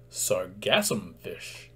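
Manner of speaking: drawn-out, clear teacher's naming; slow careful naming. Shown as a demonstration. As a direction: say the name slowly and clearly. Teacher names the new fish.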